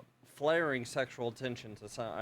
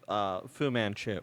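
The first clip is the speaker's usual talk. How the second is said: usual speaking voice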